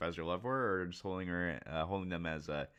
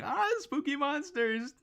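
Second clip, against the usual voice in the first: high, slow mock panic; scared henchmen; 4 dB louder, slower than usual. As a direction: squeal like a frightened henchman. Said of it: "henchmen are going".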